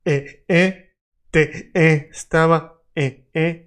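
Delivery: eh, eh, estaba cut into separate stops; choppy vowels. Shown as a demonstration. Teacher showing the wrong choppy te estaba. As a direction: say te estaba with breaks between vowels. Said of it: "Don't say".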